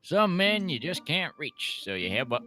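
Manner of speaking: slow Southern drawl, quoting the film; lightly voiced Southern prison boss from Cool Hand Luke. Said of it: "I picked up this southern accent from the film Cool Hand Luke".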